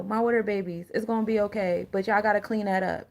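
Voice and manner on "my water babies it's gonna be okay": ordinary talk, nothing marked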